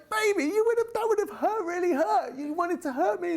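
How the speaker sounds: put-on a parent stunned by a toddler's headbutt; amazed to child. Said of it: "I was like".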